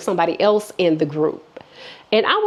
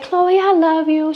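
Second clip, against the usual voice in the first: put-on drunk friend; 7 semitones higher than usual. Drunk friend repeating her love, high and pleading. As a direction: mimic the tipsy friend pleading. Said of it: "she was like".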